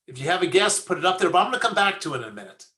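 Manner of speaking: ordinary delivery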